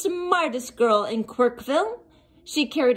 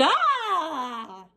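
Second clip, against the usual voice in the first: mock scream; 5 semitones higher than usual. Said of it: "the townspeople all screamed".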